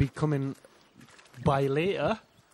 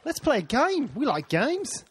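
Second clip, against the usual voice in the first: high, eager game pitch; excited; 8 semitones higher than usual. Pretend. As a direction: pitch it up like a kid wanting a game. Excited suggestion, pitch jumps.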